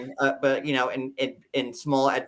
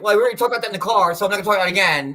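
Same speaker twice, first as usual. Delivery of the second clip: rapid, louder retold refusal; quick, dismissive mock retort; brush-off; 3 semitones higher, 5 dB louder, much faster than usual. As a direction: rattle it off to shut down talk. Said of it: "and he's like".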